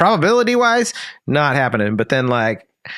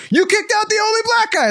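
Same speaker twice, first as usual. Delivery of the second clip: very high indignant mock voice; mock accuser. Mocking bandmates who cry racism, very high. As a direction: squeak it out like an outraged busybody.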